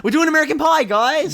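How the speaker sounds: big loud surprise reveal; excited. Real, not acted. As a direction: shout it out like a reveal. Surprise announcement of next franchise.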